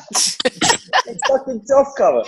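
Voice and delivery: drawn-out mock sergeant. Mocking the sergeant's phrase.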